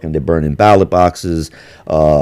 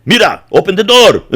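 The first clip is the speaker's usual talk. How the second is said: higher, laughing tough-guy voice; mock gang demand; 9 semitones higher than usual. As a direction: demand the job like a mock thug. Mocking gang members demanding jobs.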